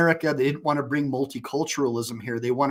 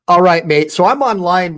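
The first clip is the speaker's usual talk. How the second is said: louder, higher call to Paul on the phone; 4 semitones higher, 10 dB louder than usual. Real, not acted. Lawyer calling his guest on the phone, louder. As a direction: hail him over the phone line.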